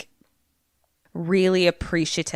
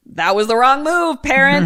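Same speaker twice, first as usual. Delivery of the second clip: mock scolding; 7 semitones higher, 7 dB louder than usual. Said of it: "both Eric and I were like".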